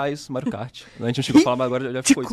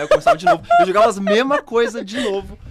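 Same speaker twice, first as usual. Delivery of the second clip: much higher and louder, breaking into laughter; laughing; 4 semitones higher, 8 dB louder than usual. Real, not acted. Grandson laughing at restarting the game every week. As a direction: laugh through it, high and loud.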